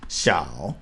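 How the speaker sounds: "xiao" low, dipping and rising; third tone. Shown as a demonstration. Teacher shows learners the low dipping third tone of "xiao". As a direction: dip "xiao" low, then rise. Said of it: "the third tone".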